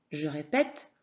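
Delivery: ordinary talk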